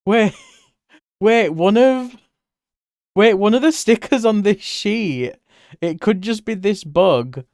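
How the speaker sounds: sudden excited brainstorm. Real, not acted. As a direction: blurt out the idea, thrilled. Excited idea about sticker sheet.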